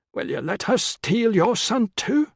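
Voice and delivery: high, slow taunting woman's voice; Enika's taunt. A narrator's reading. Enika goading Senesio about his son, high and slow. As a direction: needle him with a drawn-out jab. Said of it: "she heard Enika say".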